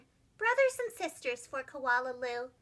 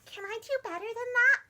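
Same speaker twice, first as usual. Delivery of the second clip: wondering; 6 semitones higher than usual. Acted. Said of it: "wondered Koala Lou".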